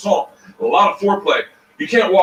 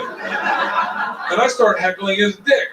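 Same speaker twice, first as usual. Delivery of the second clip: ordinary delivery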